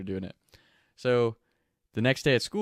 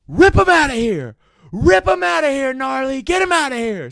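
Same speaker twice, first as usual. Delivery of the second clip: high, loud shouted order; furious yell; 12 dB louder than usual. Retold or imitated. Principal yelling to the dean to drag the kid out. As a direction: bellow it across the gym.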